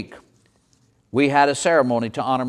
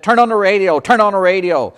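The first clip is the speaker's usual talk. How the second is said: urgent, louder call to turn on the radio; bursting in with news, calling out; alarmed shout; 5 semitones higher, 5 dB louder than usual. Retold or imitated. Someone runs in with urgent news.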